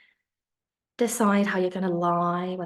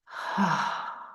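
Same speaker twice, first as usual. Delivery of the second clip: long, slow sigh out through the mouth; demonstrated sigh; 2 semitones lower than usual. Shown as a demonstration. Teacher showing students how to sigh out, long and slow. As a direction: show the class how to let the breath go. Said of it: "sigh them out of the mouth".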